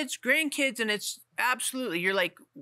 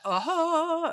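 drawn-out, high mock realization; a lifted, playful "aha"; aha of epiphany; 9 semitones higher than usual. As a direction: act out a sudden revelation, pitch soaring. Acts out moment of epiphany.

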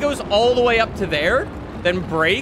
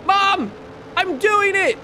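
put-on higher, childlike "look at me" call; mock proud kid; 7 semitones higher than usual. Joking proud shout to mom.

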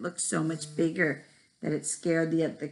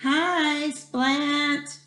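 put-on chorus of kids; 8 semitones higher than usual. Class greets Splat in chorus.

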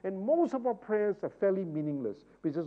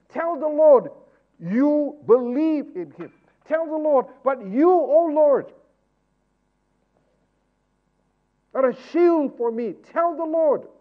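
higher, louder preacher's exhortation; full-force preaching; 10 semitones higher, 14 dB louder, slower than usual. Acted. Preacher exhorting his church to pray, fervent. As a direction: drive the congregation to pray boldly.

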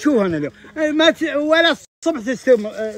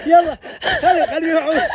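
laughing tease; 6 semitones higher, 4 dB louder than usual. Real, not acted. Teasing the cook, laughing.

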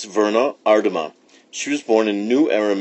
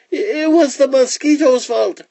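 very high, stammering iguana voice; blaming iguana; 4 dB louder than usual. Acted. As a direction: stammer out the blame, high-pitched. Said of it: "said the iguana".

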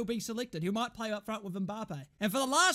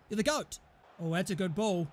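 ordinary talk, nothing marked

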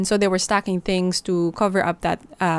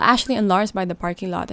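usual speaking voice

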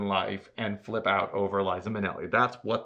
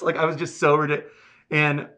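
ordinary delivery

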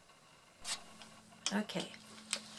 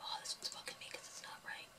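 fast annoyed whisper to herself; whispered muttering; 18 dB quieter, faster than usual. Real, not acted. Fussing at a wrong band on the loom, whispered. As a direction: grumble it under your breath, quickly.

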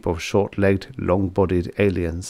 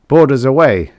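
loud, high command; firm order; 5 semitones higher, 8 dB louder than usual. Acted. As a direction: give the order crisply. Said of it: "Fisk ordered".

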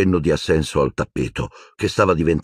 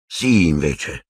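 tranquil; much slower than usual. A narrator's reading. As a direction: calmly hold the point. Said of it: "ribatté Silente tranquillo".

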